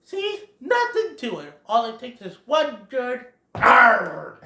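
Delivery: high, cocky show-off voice; Thumper. Acted. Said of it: "Thumper's all like".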